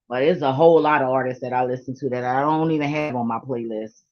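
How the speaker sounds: ordinary talk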